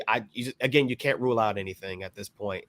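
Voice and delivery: normal talking voice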